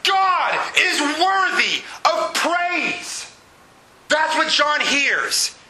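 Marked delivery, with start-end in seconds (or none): 0.0-3.3 high, slow preacher's cry; emphatic proclamation. Real, not acted. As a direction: proclaim it word by word in praise. Proclaims what hallelujah means.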